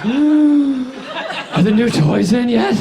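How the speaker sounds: very slow, high breathy question; breathy caller. Retold or imitated. Breathy caller asking McDonald's about toys, slow and high. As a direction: breathe every word out like a creepy caller. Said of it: "breathiest phone call of all time".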